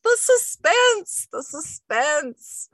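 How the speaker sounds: drawn-out, high theatrical "the suspense"; milk the suspense like a stage villain; dramatic suspense. Retold or imitated. Reading a chat comment aloud, hammed-up melodrama. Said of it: "somebody's like".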